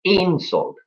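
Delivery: "Insult." with IN high and long, falling after; stress on first syllable. Shown as a demonstration. Teacher isolates the noun, "Insult." stressed on IN. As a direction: punch the IN of "Insult.", drop the rest. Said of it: "the accent goes on the first part".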